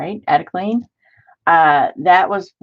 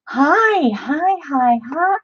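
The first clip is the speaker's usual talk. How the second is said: excited hi; 11 semitones higher, much slower than usual. Real, not acted. Greeting live chat arrivals excitedly.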